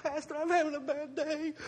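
high, put-on whiny voice; complaining to the pastor in a high whine; whiny plea to pastor. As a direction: whine like a needy kid to the pastor. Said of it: "I'm like".

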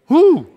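high loud church whoop; joyful whoop. Real, not acted. Pastor whooping to the congregation in joy.

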